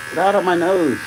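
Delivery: loud, high frustrated yell. Real, not acted. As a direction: shout it at the nose hair. Yelling at a nose hair.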